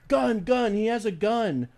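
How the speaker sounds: high, alarmed mock shout of gun; panicked cop yelling. Cop to the unarmed man, panicked mock yell. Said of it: "starts going".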